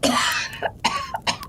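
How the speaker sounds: hard choking cough; woman choking on smoke coughs; smoky coughing fit. Acted.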